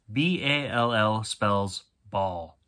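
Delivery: each letter B, A, L, L said slowly and separately; slow spelling out. Shown as a demonstration. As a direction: spell "B A L L" letter by letter, then "ball".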